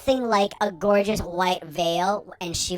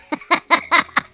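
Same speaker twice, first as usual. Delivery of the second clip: laugh; 10 semitones higher, 8 dB louder than usual. Real, not acted. Laughs at own bad witching.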